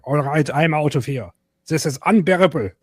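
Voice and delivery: hammed-up outraged despot giving up in disgust; Hitler. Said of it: "he'd probably be like".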